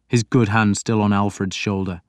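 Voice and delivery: ordinary talk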